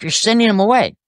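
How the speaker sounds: indignant boss. Retold or imitated. Owner objects to his method.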